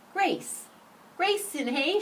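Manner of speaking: high, excited call; shouting. A narrator's reading. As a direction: cry it out in delight. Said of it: "shouted Ralph".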